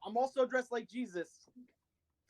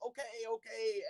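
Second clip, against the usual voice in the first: put-on hurt old man; 2 semitones higher, 7 dB quieter than usual. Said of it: "pretend that he hurt my back real bad because I'm old and go".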